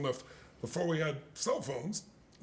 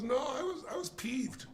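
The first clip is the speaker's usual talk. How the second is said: retold protest; 8 semitones higher, slower than usual. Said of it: "he goes".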